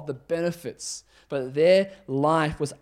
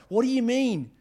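higher-pitched baffled indignation; confused protest; 5 semitones higher, 5 dB louder than usual. Acted. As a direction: demand an explanation in disbelief. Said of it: "you'd be pretty confused as well".

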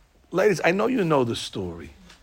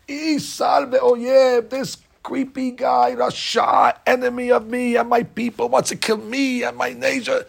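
higher, slow, drawn-out accusation; lightly voiced queen pointing out the enemy; Esther accusing; 6 semitones higher, slower than usual. Esther denounces Haman to the king, higher and slow.

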